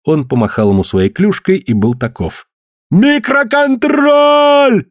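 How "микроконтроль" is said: very high, long drawn-out yell; desperate high-pitched holler. A narrator's reading. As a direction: yell it out, stretching the last syllable. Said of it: "заорал Коллинз".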